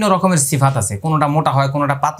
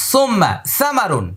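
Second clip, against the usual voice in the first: সুম্মা সামারুন slow in Arabic; Arabic phrase; 7 semitones higher, slower than usual. Retold or imitated. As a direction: draw out সুম্মা সামারুন as Arabs do. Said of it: "আরবরা বলে".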